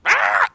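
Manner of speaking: short bird screech imitation; a quick gull cry; mock seagull squawk. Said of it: "I can't do an actual seagull call".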